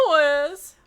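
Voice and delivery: loud, high hollered name; high shouted hello by name; Nicole Byer. Shown as a demonstration. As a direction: call it out in big greeting. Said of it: "Just like that".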